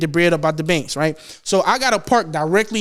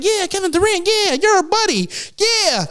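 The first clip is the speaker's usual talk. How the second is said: much higher taunting sing-song; high mocking heckler; 11 semitones higher than usual. Fans taunting Kevin Durant, high mocking voice. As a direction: tease him like a clueless fan. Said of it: "they was like".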